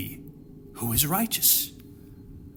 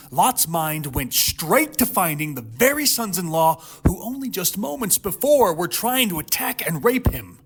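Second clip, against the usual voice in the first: ordinary talk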